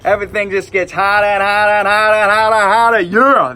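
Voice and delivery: fast, loud, cranked-up New England accent; Boston accent. Shown as a demonstration. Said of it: "over in Maine and Boston and Rhode Island".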